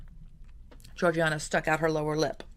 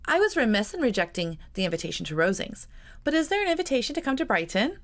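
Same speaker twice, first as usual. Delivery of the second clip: higher, quickening, all innocence at the end; innocent; 6 semitones higher than usual. A narrator's reading. Georgiana asking her cousin about Brighton, innocently. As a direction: ask as if butter wouldn't melt. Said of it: "she asked innocently".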